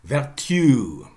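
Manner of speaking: "vertu" as ver-TYOO, r sounded; Chaucer-style "vertu", long second syllable; Middle English pronunciation. Shown as a demonstration. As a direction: say "vertu" with y-glide and final stress. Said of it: "In Middle English, it would come as".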